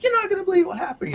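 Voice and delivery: very high, rushed announcement; giddy mock phone-call voice; retold excited news. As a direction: re-enact breathlessly spreading big news, fast and high.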